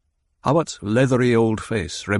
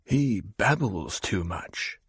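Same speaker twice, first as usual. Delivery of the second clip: slow, softer private thought; inner thought; 5 dB quieter, slower than usual. Acted. Hawat privately judges the Baron, slow and soft. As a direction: mutter it to yourself. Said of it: "Hawat thought".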